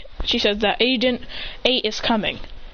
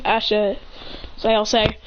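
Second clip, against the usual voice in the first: ordinary delivery, nothing marked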